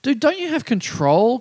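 slow, exasperated appeal; incredulous plea. Acted. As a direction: plead in mock disbelief at someone failing. Mock plea to falling man.

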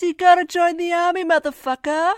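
high, loud, brash demand; mock tough-guy ordering the journalist to enlist; Peter Berg impression. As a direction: bark the order like a pushy director. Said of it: "And he goes".

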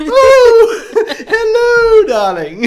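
very high, loud British nanny trill; exaggerated falsetto nanny, laughing; Missus Doubtfire. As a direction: burst into a whooping, hammed-up nanny greeting. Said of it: "sounded a bit like Missus Doubtfire".

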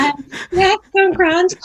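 high, loud, flustered fib; nervous cover-up. Retold or imitated. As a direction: imitate mum sweating through a lie. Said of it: "like, a bead of sweat".

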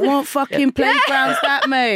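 high, slow, laughing through it; laughing disbelief. Retold or imitated. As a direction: laugh while asking it. Incredulous quote, laughing.

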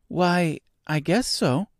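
put-on high, tentative girl's answer; young Dorothy. A narrator's reading.

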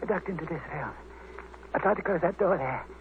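weak dying voice. Acted. Dying man gasps out his story.

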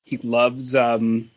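normal talking voice

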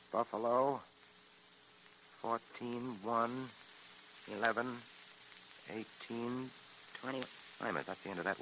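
muttering to himself. Acted. Tracy slowly decoding under breath.

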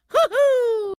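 high falsetto hoo-hoo yelp; cartoon plumber's falsetto whoop; Mario whoop. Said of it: "Mario cartoon whoop".

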